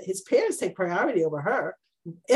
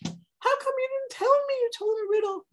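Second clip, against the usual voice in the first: very high, wounded pleading; mock weeping wife. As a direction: whine it like she's in tears. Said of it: "And she's crying".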